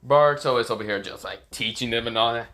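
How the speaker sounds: usual speaking voice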